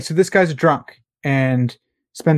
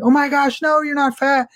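very high, squeaky girl voice, hammed up; mock crying girl; 12 semitones higher than usual. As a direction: play the girl sobbing reassurance in falsetto. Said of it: "And the other girl's crying, like".

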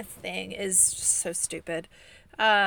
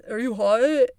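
lightly voiced guy on an intercom, raised pitch; imitated male voice; 4 semitones higher, 8 dB louder than usual. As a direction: imitate a man calling out a question. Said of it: "It's a, a male voice".

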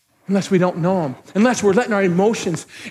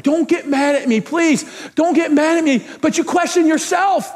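higher, pleading preacher's push; insistent preaching; 8 semitones higher than usual. Real, not acted. Pastor asking church to question themselves, insistent preaching. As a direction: urge them hard from the pulpit. Urges congregation forcefully.

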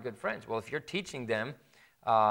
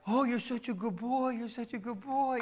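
high, sing-song praise for a good dog; doggy praise voice; 10 semitones higher than usual. Retold or imitated. Preacher mimics an owner cooing at the dog, high and sing-song. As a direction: coo it up high like praising a puppy.

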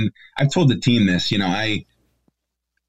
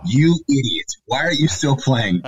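imitating upset wife; 4 semitones higher than usual. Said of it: "I could just hear the tears".

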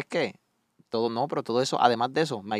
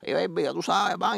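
higher, easygoing street greeting; breezy slang to friends; a light touch of street swagger; casual slang; 7 semitones higher than usual. Retold or imitated. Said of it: "tú me tiro así".

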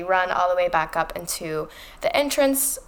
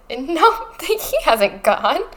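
high, loud, drawn-out protest; imitated disbelief with a laugh; Harry in denial; 7 semitones higher, 4 dB louder, much slower than usual. Harry refusing to believe his godfather died, mock denial.

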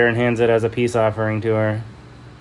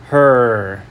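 ordinary delivery

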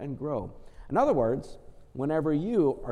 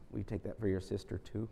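ordinary delivery

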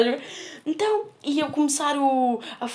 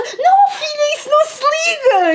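high, loud laughing scold as grandma; grandma shouting; 13 semitones higher, 6 dB louder than usual. Retold or imitated. Girl retelling grandma stopping her phone calls, laughing shout. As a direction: bellow no at the child, cracking up. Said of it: "E ela".

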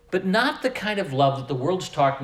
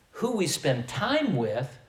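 ordinary talk

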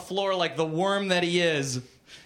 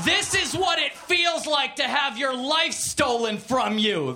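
higher-pitched, forceful indignant outcry; raised voice, short of screaming; mild narrated scream; 6 semitones higher than usual. A narrator's reading. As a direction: declaim it as an avenging cry. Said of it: "they all screamed".

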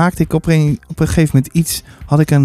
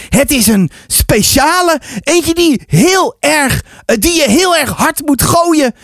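put-on higher, emphatic child's voice; eager boy; 9 semitones higher than usual. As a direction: explain it like an excited boy. Boy explains his special key brick.

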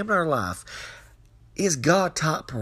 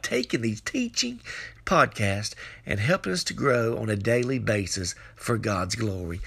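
normal talking voice

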